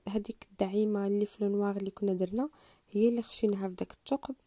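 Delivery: usual speaking voice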